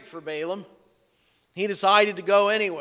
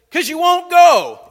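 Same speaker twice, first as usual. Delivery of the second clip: much louder and higher, an indignant shout; hammed-up furious shout; 12 semitones higher, 13 dB louder than usual. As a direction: yell back at the donkey as if it were normal. Balaam snaps back at talking donkey.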